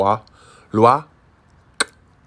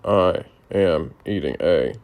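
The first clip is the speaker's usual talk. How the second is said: slow repetition; slower than usual. Shown as a demonstration. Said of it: "repeats slowly after tutor".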